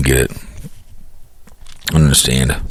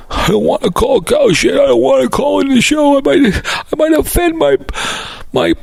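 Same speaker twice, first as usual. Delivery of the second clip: much higher, whiny mock voice; mocking Dave. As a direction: squeak it out like a scared wimp. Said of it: "I knew Dave wouldn't call".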